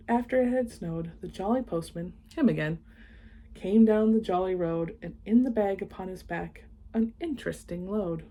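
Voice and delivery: usual speaking voice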